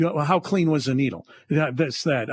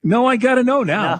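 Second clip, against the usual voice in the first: insistent; 6 semitones higher, 5 dB louder than usual. Real, not acted. Host demands the withheld story.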